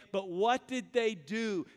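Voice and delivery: ordinary talk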